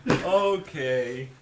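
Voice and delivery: knowing laugh. Retold or imitated. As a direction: burst out in knowing triumph, then settle in. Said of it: "I was like".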